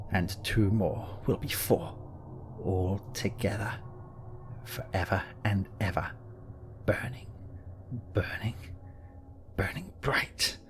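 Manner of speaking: soft, eerie, fading to a whisper. A narrator's reading. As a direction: let it sink into a whispered chant. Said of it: "his voice lowering strangely".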